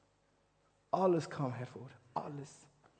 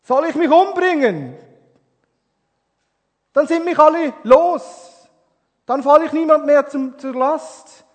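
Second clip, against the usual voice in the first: high, loud despairing cry; desperate shouting; 9 semitones higher, 17 dB louder than usual. Retold or imitated. Retelling the suicidal young man's phone call, desperate shout. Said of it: "er schrie mich an am Telefon".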